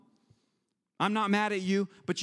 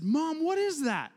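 put-on child asking; 8 semitones higher, slower than usual. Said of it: "So I said".

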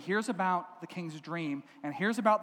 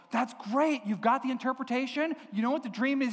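higher-pitched, eager buddies; excited questioning; 6 semitones higher than usual. Acted. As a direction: react as thrilled friends checking the news.

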